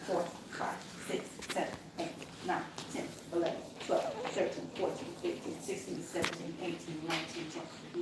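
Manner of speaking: soft counted compressions; counting compressions. Shown as a demonstration. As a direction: count the pushes quietly. Counting compressions under her breath.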